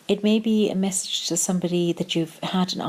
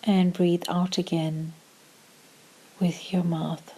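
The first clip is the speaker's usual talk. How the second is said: calm meditation voice with long pauses; short, measured phrases, leaving room to breathe; guided meditation; slower than usual. Acted. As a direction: guide them gently into stillness, unhurried.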